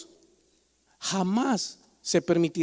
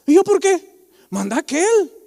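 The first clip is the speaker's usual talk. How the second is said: higher, louder mocking insolent reply; mock defiant worker; 8 semitones higher, 6 dB louder than usual. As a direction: imitate a cheeky employee talking back. (imitando a un empleado insolente)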